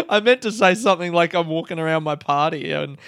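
ordinary talk, nothing marked

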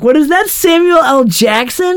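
high startled yelp of a question. Retold or imitated. Tony startled by a voice in his house, high yelp. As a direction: jump up in pitch, startled.

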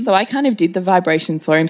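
ordinary talk, nothing marked

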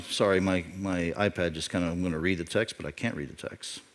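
low, soft aside, trailing away; muttered apology. Real, not acted. Preacher apologizing to church about his iPad, muttered aside. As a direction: drop low and quiet, off the sermon.

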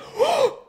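sharp, high-pitched shocked gasp. Retold or imitated. Juliet sees dead Romeo.

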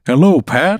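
a strange little thing greeting Pat; quilt creature voice. Acted. Scene: a living quilt greets Pat at the foot of the bed, high and loud.

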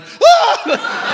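very high, loud yelp; hammed-up driver's scream; sudden panicked shriek, played for laughs; startled scream. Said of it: "and I'm like".

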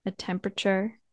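soft, unhurried meditation voice with long pauses; guided meditation. Acted. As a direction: gently guide listeners inward, leaving space between phrases.

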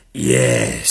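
low, loud drawn-out yes; dragon schoolmistress. Acted. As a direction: confirm it grandly to the class. Dragon teacher confirms.

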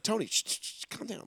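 softly shushing, dropping to a whisper; calm him down in a hush; whispered shh to settle a colleague. Retold or imitated. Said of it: "trying to, like, rein him in".

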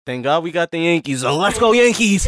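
building high loud chant; cheering. Real, not acted. Rising cheer for team.

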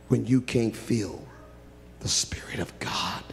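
a broken hushed whisper; tearful whisper. Acted. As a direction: breathe it out, near tears. Preacher lowers to tearful hush.

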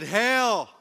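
loud, high, slow sneering hail; mock him like jeering soldiers. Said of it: "They mocked him to his face".

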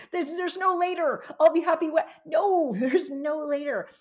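high, loud retold self-talk, laughing through it; emphatic self-correction. Speaker telling herself off on the plane, emphatic and amused. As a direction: override your own excuse with an amused, forceful no.

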